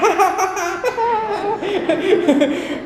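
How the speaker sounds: high, loud peals of laughter; acted hearty laugh. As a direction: burst into a big gleeful laugh. Acts out gleeful giving at offering.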